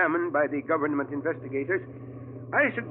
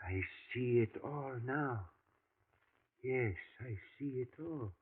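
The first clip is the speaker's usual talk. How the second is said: much softer, low dawning realization; hushed realization; 4 semitones lower, 13 dB quieter than usual. Acted. Professor realizes the rancher is an alien, hushed. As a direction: let the truth sink in, almost to yourself.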